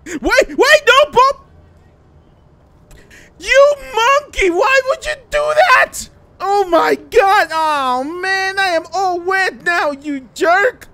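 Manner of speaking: high, loud shriek of protest; outraged yelling. Acted. Friend shoved into the water scolds Bobby, outraged. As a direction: yell at the culprit, stretching the words in outrage.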